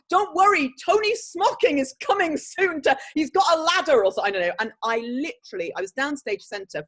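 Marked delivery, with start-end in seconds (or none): 0.1-4.0 high, loud imitation of a fellow actor; actor's stage line. Actor sneaking a joke character into the play, loud mock stage delivery. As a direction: call it out like a hammy stage actor. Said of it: "one of the actors came on and said".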